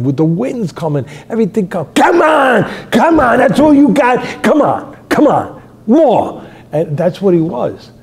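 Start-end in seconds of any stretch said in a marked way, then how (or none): 1.9-6.4 high, loud screaming, fading then surging back; defiant storm scream. Retold or imitated. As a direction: bellow a dare at the storm. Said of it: "He was like s-, the sergeant up on the top of the mast".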